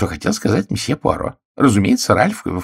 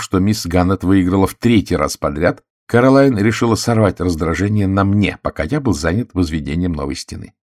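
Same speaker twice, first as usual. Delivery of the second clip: usual speaking voice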